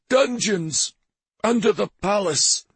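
high, panicked sputter. A narrator's reading. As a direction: blurt it out in a panic. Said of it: "The priest sputtered".

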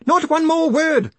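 high, loud outraged cry; frantic scream of a furious man. A narrator's reading. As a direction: shriek it to silence the room. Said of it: "screamed Grinium".

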